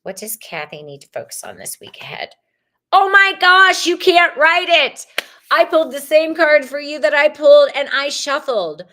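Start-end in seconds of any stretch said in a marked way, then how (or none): 2.9-8.8 much higher and louder amazed outburst; real excited surprise. Real, not acted. Reader amazed at pulling the same card for Kathy, excited. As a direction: gasp it out, thrilled by the coincidence.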